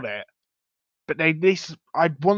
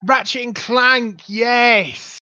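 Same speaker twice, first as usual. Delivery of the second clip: excited; 9 semitones higher, 5 dB louder, much slower than usual. Real, not acted. Recognizing the game name excitedly.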